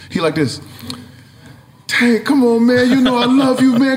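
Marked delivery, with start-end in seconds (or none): 1.9-4.0 effusive welcome. Retold or imitated. Retold R. Kelly greeting.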